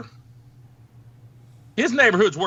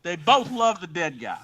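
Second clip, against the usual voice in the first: normal talking voice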